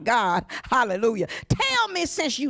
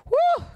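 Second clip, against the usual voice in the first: very high woo cry; ecstatic whoop; 3 dB louder than usual. Real, not acted. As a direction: let out a joyful whoop. Preacher overcome with fervour.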